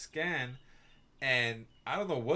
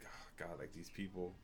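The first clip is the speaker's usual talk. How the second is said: exasperated mutter; 5 semitones lower, 13 dB quieter than usual. Retold or imitated. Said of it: "He just shook his head".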